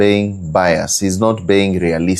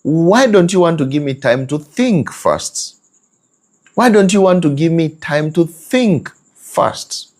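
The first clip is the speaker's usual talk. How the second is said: tag; 8 semitones higher than usual. Retold or imitated. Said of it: "you wonder".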